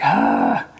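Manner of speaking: low breathy "Ah" of frustration; exasperated groan. Retold or imitated. Daughter stuck waiting the whole weekend, groaning. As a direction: let out a voiceless groan of frustration. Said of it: "She's like".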